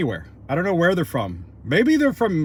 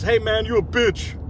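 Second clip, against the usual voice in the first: imitating a passerby's higher-pitched jeer; passerby's taunt; 6 semitones higher, 4 dB louder than usual. Passerby heckles him outside the store, mock taunt. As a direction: lightly mimic a heckler calling out.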